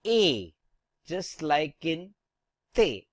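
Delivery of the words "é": A said as French é, a pure "ay"; closed ay on A, falling; acute-accent E. Shown as a demonstration. Teacher shows what E becomes with acute accent, closed ay. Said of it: "it becomes".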